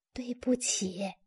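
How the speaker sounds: soft, slow apology. A narrator's reading. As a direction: say sorry under your breath. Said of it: "艾玛压低声音说".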